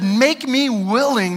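drawn-out, slow plea; slow surrendered prayer; slow earnest plea. Retold or imitated. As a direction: say it slowly, surrendering. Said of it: "And when he said".

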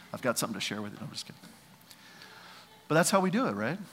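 ordinary talk, nothing marked

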